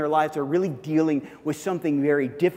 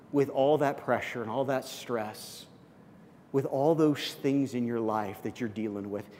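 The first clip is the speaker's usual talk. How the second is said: gentle concern; 3 semitones lower, 5 dB quieter than usual. Pretend. Comforting a struggling coworker at lunch, soft gentle concern. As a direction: ask gently with real concern. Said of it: "And you say".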